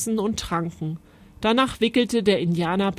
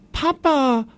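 higher, calling out to father in alarm; 7 semitones higher, 3 dB louder than usual. A narrator's reading. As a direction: call for papa in a fright. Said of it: "rief Schneller Wind".

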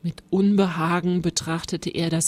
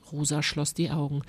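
ordinary delivery, nothing marked

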